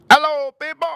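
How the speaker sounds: high, loud put-on greeting; imitating a viral guy. Vlogger mimicking a viral video drinker's greeting, high and loud. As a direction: mimic his odd way of saying hello. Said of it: "that's how he talked".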